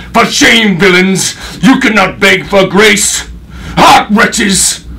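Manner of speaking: high, loud outburst of outrage; furious stage shout. Acted. Avenging father berates the bound villains, furious shout. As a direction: shame them at full voice.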